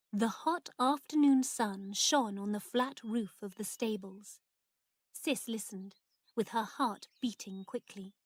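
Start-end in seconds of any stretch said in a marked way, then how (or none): none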